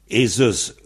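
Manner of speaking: Eses said with a voiced z in the middle; "Eses", the z sounded clearly. Shown as a demonstration. Breton teacher sounds out Eses with a z. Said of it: "E Z".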